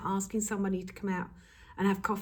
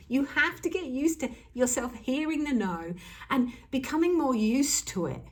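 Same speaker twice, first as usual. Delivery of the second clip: normal talking voice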